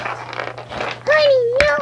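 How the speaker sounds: very high sweet call. Pretend. Toy calls.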